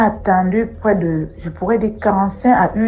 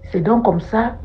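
ordinary delivery

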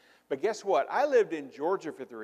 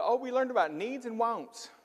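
put-on little girl voice; 8 semitones higher than usual. Kindergarten daughter answers grandmother.

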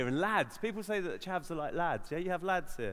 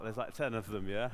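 usual speaking voice

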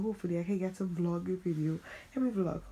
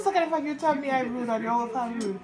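laughing protest; 6 semitones higher, 6 dB louder than usual. Real, not acted.

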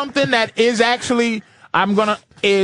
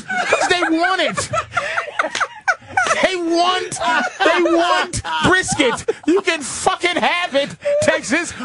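higher, laughing, worked-up rant; exasperated laughing complaint; 7 semitones higher than usual. Real, not acted. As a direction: push it out half laughing, half yelling. Rant about Texas brisket.

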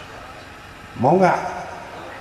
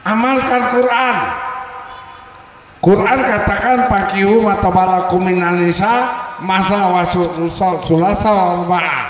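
high, loud sermon delivery; fiery preaching; 6 semitones higher, 5 dB louder than usual. Acted. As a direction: preach it out with full force. Preaching the Quran verse. (ceramah berapi-api)

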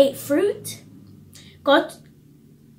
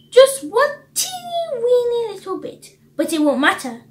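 insistent coaxing snake; 9 semitones higher, 3 dB louder than usual. A narrator's reading. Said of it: "snake insisted".

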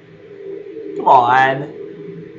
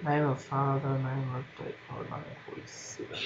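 much softer reading aloud; muttered reading; 3 semitones lower, 15 dB quieter than usual. Real, not acted. Player reads a clue quietly to himself, soft. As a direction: read it under your breath.

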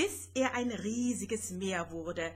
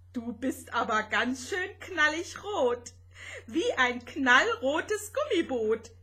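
high, loud playful tease; giggly teasing; 4 semitones higher, 5 dB louder than usual. A narrator's reading. Little spaceship teasing Rosetta about being red, giggly. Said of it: "kicherte Vanya".

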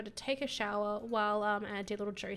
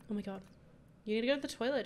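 ordinary delivery

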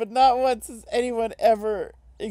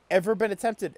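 ordinary delivery

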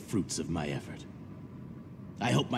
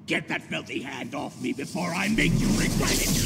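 louder, rising into an enraged threat; angry threat; 5 semitones higher, 3 dB louder than usual. Acted. Lord threatening the commoner who touched him, enraged. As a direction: spit it at the commoner, building to a shout.

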